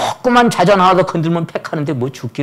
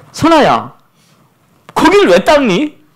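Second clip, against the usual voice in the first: higher, louder, slow puzzled grandpa; amused scolding; 11 semitones higher, 7 dB louder than usual. Retold or imitated. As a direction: scold while fighting a laugh. Grandfather questioning child, holding laughter.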